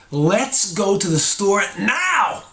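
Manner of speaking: annoyed demand, each word hammered out, NOW stretched high. Shown as a demonstration. Speaker demonstrating how tone turns a sentence negative, annoyed. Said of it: "I sound pretty annoyed".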